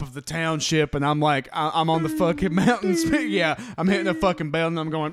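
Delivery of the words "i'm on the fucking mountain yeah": ordinary delivery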